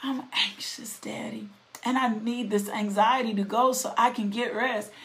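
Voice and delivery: soft unvoiced whisper to God; a quiet whispered confession to God; whispered prayer. Shown as a demonstration. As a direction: whisper the worry to your father. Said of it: "saying to the Lord".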